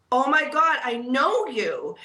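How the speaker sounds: a stunned burst of recognition; surprised recognition. Retold or imitated.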